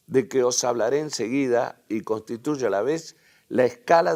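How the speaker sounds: formal public-address reading; reading a speech aloud. Acted. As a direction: read the statesman's address out to the hall.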